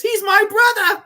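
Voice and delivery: high, loud hammed-up reveal; savoring delivery. Quoting the favourite line, high and loud. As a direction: relish the line like a ham. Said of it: "the way he delivers that line".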